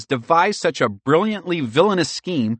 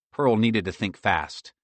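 usual speaking voice

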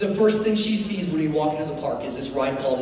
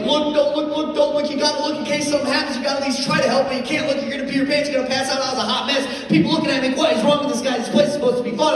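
high, frantic panicked babble; hammed-up panic, voice way up; freaking out; 12 semitones higher, 5 dB louder, faster than usual. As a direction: spiral into loud, high-pitched comic hysteria. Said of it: "I'm freaking out".

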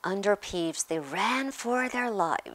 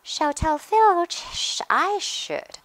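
high, loud mock-pious poltergeist; saintly voice; 11 semitones higher, 7 dB louder, slower than usual. A narrator's reading. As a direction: play the sanctimonious imp, pitched high. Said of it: "said Peeves in a saintly voice".

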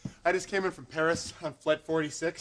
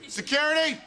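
calling out; 10 semitones higher than usual. Real, not acted. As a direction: shout for help across the hall. Calling out for security.

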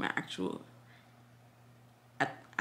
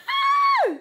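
very high, sharp squeal of joy; high-pitched happy scream; delighted squeal; 5 dB louder than usual. Real, not acted. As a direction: let out an excited shriek.

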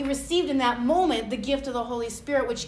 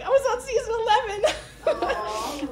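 laughing imitation; 9 semitones higher than usual. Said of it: "She's like".

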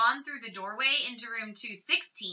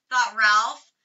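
plain reading voice

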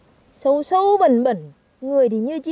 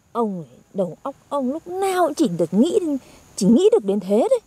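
usual speaking voice

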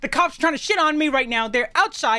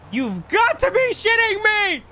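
furious outburst; 7 semitones higher, slower than usual. Acted. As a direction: blow up at the news, dragging each word. Furious outburst at arriving cop.

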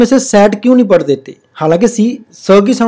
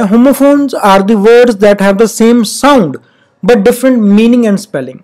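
very slow reading of the definition; slow classroom dictation; much slower than usual. Shown as a demonstration. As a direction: read it out slowly for the class. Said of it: "reading the definition slowly".

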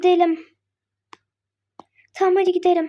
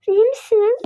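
usual speaking voice

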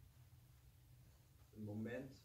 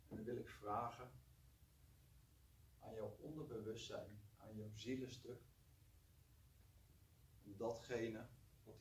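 guided meditation. Acted. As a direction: gently guide them to relax. Leads a short meditation.

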